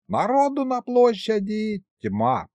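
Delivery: high, drawn-out announcement; excited report. A narrator's reading. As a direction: stretch it out high with amazement. Михалыч brings news from the square. (взволнованно, нараспев)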